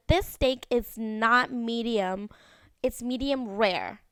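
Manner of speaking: slow, deliberate retold complaint; mock complaining. Karen complains to waiters about her steak, slow mock complaint. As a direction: spell it out like an entitled diner. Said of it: "she started complaining about the food".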